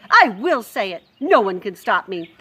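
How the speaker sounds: loud stubborn declaration; defiant cook. Acted. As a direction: dare them to stop you.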